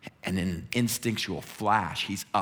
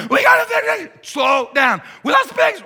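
frantic, very high, louder babbling; panicked herders; 12 dB louder than usual. Acted. Young herders telling the town they lost the pigs, frantic. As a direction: blurt it out breathless with panic.

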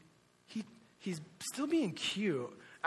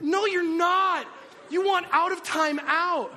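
high, loud mock parental shout; angry scolding; 12 semitones higher, 9 dB louder than usual. Dad rejecting toddler's time-out apology, loud exasperated shout. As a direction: yell back, refusing to buy it.

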